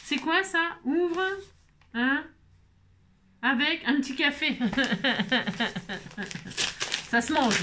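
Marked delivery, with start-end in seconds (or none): 4.6-7.0 long chuckle trailing downward; laughter. Real, not acted. Amused by the thought of a little coffee, long chuckle. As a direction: let out a run of quick chuckles.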